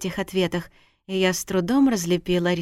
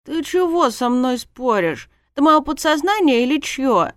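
raised pitch, loud protest; indignant; 7 semitones higher, 5 dB louder than usual. A narrator's reading. Heroine argues with her own subconscious, indignant. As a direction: tell it off in outrage. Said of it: "возмутилась я".

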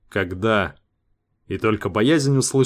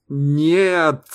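high, desperate yell; 6 semitones higher, 4 dB louder than usual. A narrator's reading. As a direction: scream it out in panic. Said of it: "заорал Сейга".

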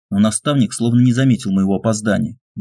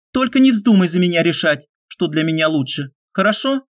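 higher female warning, rising end; firm young woman; 8 semitones higher than usual. A narrator's reading. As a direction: warn him, ending with a question. Olga warns the hero.

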